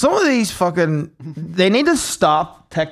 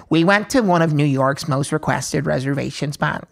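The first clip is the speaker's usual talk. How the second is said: ordinary delivery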